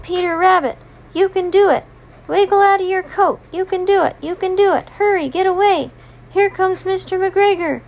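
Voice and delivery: high, chirpy cheering-on; urgent little birds. Acted. Little birds urging trapped Peter to get away, high and urgent. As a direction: urge him along in a high little bird voice.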